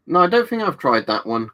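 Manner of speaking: ordinary talk, nothing marked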